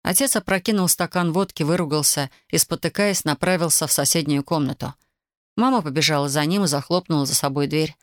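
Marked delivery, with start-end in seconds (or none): none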